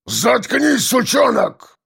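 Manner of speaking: high-pitched furious bark; acted angry outburst; explosive roar. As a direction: snap and roar him into silence. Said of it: "взорвался ворон, рявкнув так".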